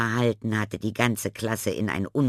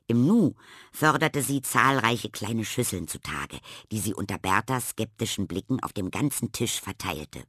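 ordinary delivery

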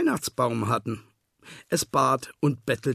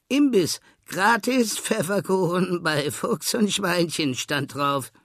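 sheep voices; 6 semitones higher, 4 dB louder than usual. A narrator's reading. Two sheep greet at door.

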